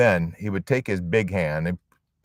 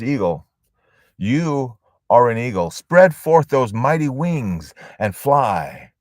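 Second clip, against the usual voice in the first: rising from plain to a loud, slow, higher call; building command; 4 semitones higher, 5 dB louder than usual. Acted. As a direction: swell into a commanding cry to the bird. Naturalist's second command, building.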